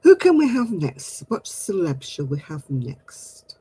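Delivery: ordinary talk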